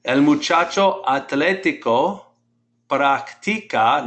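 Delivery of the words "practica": practica with a drawn-out stressed "ti". Shown as a demonstration. Teacher showing the class how to pronounce it, practica stressed on TI. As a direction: lean on the I in practica, prac-TI-ca. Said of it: "put the stress on that I".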